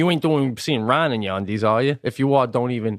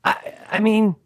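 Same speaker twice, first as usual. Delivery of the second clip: ordinary talk, nothing marked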